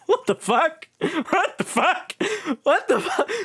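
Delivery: high, drawn-out laughing shock; stunned disbelief. Real, not acted. Streamer reacting to the massacre, laughing shock. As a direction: say it like you can't believe it.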